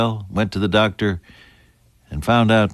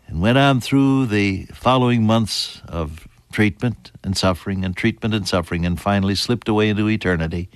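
normal talking voice

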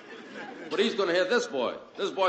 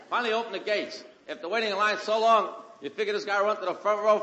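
ordinary talk

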